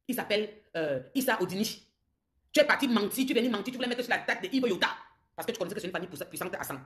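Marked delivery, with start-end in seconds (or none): 2.5-4.7 angry accusation. Real, not acted. Accusing rival of lying.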